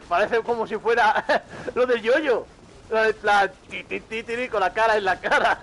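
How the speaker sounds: loud, high, cracking up mid-sentence; laughing. Real, not acted. Streamer cracking up at own joke.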